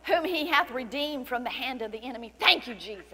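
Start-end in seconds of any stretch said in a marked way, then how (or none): none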